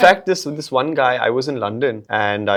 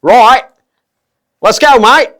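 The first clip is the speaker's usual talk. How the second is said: loud, high, throaty Cockney; Cockney accent; 10 semitones higher, 10 dB louder than usual. Acted. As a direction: bellow it like a Cockney geezer. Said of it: "Let's do a Cockney accent".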